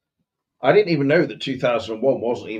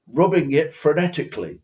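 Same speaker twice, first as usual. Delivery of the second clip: slightly raised, precise mock-posh English; faux posh accent; 4 semitones higher than usual. Posh master instructing the boy on polishing, faux posh. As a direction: enunciate like a hammed-up posh master. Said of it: "but faux posh".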